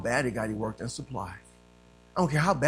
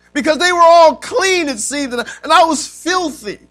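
loud, raised preaching voice; forceful preaching; 10 semitones higher, 15 dB louder than usual. Real, not acted. Preacher telling church of his search for God, forceful preaching.

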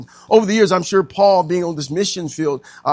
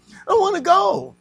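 put-on whiny child voice; 4 dB louder than usual. Voicing childish reluctance.

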